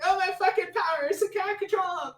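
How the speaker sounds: high-pitched hammed-up wail; mock panic. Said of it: "She's like".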